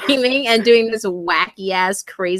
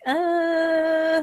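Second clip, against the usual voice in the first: high, drawn-out uneasy "uh"; reluctant uh; 8 semitones higher, 4 dB quieter than usual. Retold or imitated. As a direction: let out a long, higher, hesitant groan. Voicing her reluctant reaction to comments.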